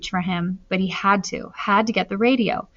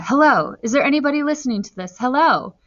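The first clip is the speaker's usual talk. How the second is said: higher, urgent call into the microphone; 5 semitones higher, 4 dB louder than usual. A narrator's reading. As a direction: call out hoping someone hears. Boy calls into dead radio.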